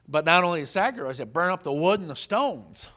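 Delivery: loud, fast preaching climax; preaching at full force. Acted. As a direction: proclaim it with fire.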